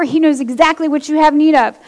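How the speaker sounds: usual speaking voice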